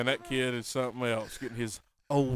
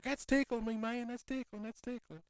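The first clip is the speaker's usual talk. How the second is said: voicing a little boy; 10 semitones higher, 5 dB quieter than usual. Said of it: "He's like".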